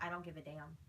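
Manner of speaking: low, soft dismissive retort; quiet defiance. Retold or imitated. Woman retelling a defiant answer to Zach, quietly defiant.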